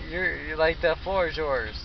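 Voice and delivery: ordinary talk, nothing marked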